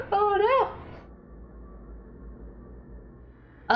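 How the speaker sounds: high, hammed-up wail of dismay; mock-tragic oh no. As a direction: pretend to be crushed by the news. Mock horror at mispronouncing.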